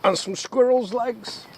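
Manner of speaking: high, slow squeaky hamster voice; hamster impression. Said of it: "That was my hamster impression".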